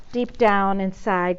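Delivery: plain reading voice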